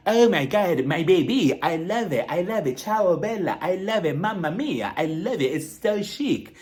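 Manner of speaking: gushing put-on foreign accent, last word drawn out; Dorit. Imitating a shopper gushing to an employee in a fake accent. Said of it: "a different accent or whatever she was doing".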